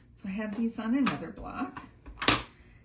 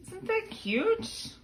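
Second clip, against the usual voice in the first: high slow gushing question; drawn-out delighted coo; 12 semitones higher, slower than usual. Real, not acted. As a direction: coo over the card. Admiring the accordion fold.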